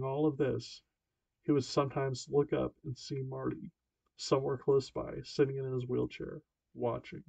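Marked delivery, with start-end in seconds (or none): none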